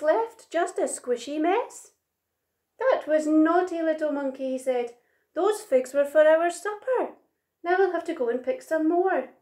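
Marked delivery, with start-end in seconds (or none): 2.8-4.5 higher, louder telling-off; scolding. A narrator's reading. Big Monkey to Little Monkey over lost figs, scolding. 5.3-9.3 higher, emphatic complaint; exasperated parent's telling-off; scolding. A narrator's reading. Big Monkey on figs meant for supper, scolding.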